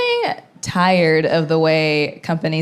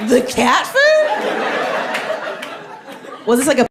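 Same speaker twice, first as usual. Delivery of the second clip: can't believe it's cat food; incredulous; 8 semitones higher than usual. Real, not acted. Baffled reaction to the ad.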